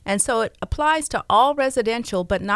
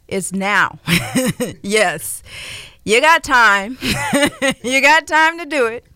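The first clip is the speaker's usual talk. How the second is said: laughing; 3 semitones higher, 6 dB louder than usual. Real, not acted. Laughing urging listeners to act.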